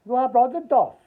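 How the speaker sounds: mimicked doctor, loud and unslowed; imitated doctor's question. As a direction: call it out as the doctor, at normal speed. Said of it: "slowing it down didn't make it any clearer".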